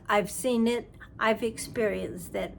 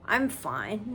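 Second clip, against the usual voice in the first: ordinary talk, nothing marked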